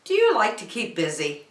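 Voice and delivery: usual speaking voice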